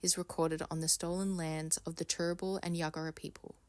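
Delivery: soft, low-key formal read; quiet, measured podcast preamble; formal acknowledgement reading. Acted. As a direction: read a solemn statement softly and evenly. Reads an acknowledgement of country.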